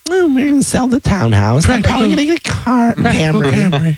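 high-pitched mock voice of a newly sensible co-host; Carmen impression. Teasing Carmen about trading party life for a Camry, high mocking impression. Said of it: "now she's like".

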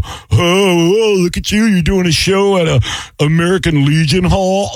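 high-pitched mock disdain; mocking outsiders. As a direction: imitate a snooty outsider looking down on the gig. Mimicking outsiders sneering at the show.

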